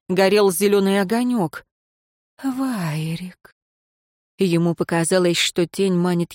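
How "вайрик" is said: soft, drawn-out ghostly call. A narrator's reading. Apparition beckons to the nobleman, soft ghostly call. Apparition calls the name again.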